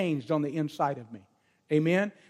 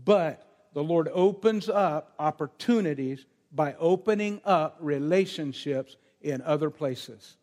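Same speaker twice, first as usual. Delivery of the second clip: normal talking voice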